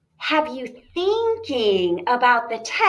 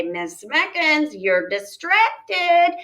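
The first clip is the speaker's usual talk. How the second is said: mock pupil scolding; 7 semitones higher, 6 dB louder, much slower than usual.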